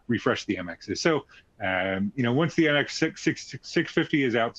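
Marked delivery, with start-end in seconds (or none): 2.3-4.6 tripping over the number; stuttering. Real, not acted. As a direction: stammer on the repeated word. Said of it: "sorry for stuttering".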